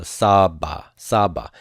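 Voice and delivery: saba said twice, accent on SA; slow, falling saba, first syllable stressed. Shown as a demonstration. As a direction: lean on the SA of saba. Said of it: "keep the accent on the first root letter".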